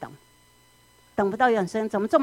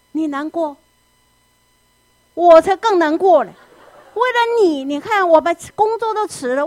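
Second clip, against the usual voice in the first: loud, much higher self-pitying tirade; aggrieved angry mother; 6 semitones higher, 6 dB louder than usual. Acted. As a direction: throw every sacrifice in the child's face. Parent venting grievances at crying child. (母亲对哭泣的孩子大声数落自己的委屈)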